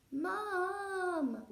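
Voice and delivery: loud high wail for mum; child's wail to his mother. Acted. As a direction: call out whining for mum. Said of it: "wailed Peter".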